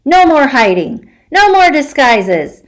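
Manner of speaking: put-on a child's fierce defiant yell. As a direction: shout your resolve, building. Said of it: "I yell".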